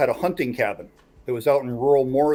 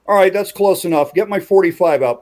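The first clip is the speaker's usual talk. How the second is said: louder, higher firm order; firm command; 5 semitones higher, 6 dB louder than usual. Retold or imitated. Uncle on the porch ordering his wife for the pistol, louder firm command. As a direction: tell her it's time, no arguing. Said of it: "to his wife and goes".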